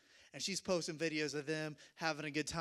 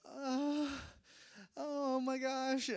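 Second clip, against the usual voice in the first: high, slow whimper of dismay; mock near-crying; 9 semitones higher, much slower than usual. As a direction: act abandoned and about to cry, hammed up. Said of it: "I'm not gonna cry".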